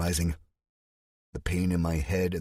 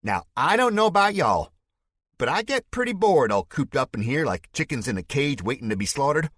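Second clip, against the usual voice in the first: Spike's fake Southern accent; 10 semitones higher, 4 dB louder than usual. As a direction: denounce him to the crowd in a put-on Southern voice. Villain stirring up the crowd.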